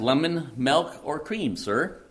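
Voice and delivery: a hint of a polite butler; butler offering choices. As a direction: list the options in a high questioning lilt. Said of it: "The butler then asked".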